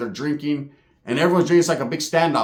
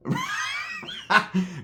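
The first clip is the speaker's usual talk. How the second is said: big laugh; 6 dB quieter than usual. Real, not acted. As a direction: crack up laughing at the punchline. Laughs at own story.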